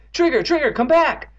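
high, loud urgent shouts; frantic calling. A narrator's reading. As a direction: call the runaway dog back frantically. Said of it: "calling frantically".

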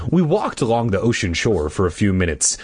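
ordinary delivery, nothing marked

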